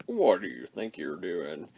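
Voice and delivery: a big robot asking calmly, looming; giant robot. Retold or imitated. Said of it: "Metroplex is kinda s-, sitting there going".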